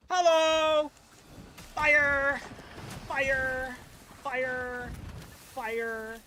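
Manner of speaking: loud high cry, fading; shouted call. Pretend. Gamer yelling fire during the boss fight, loud. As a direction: yell it out then let it drop.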